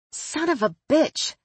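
high yelp of a curse, no accent; startled curse in own accent. Real, not acted. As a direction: blurt it out in your normal American voice after hitting your own face. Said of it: "I repeat, in accent".

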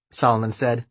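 plain reading voice

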